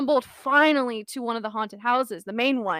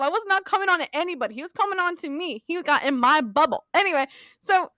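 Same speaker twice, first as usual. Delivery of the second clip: ordinary delivery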